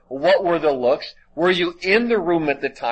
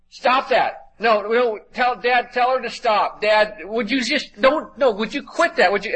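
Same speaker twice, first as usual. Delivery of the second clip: put-on higher-pitched whiny kid yelling at a sibling; mock kid yelling; 8 semitones higher than usual. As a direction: act out a little brother tattling to Dad. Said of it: "the younger brother would yell out loud".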